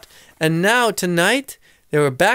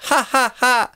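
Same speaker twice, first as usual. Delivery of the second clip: mocking laugh; 7 semitones higher than usual. A narrator's reading. Mister Dart laughs at his joke.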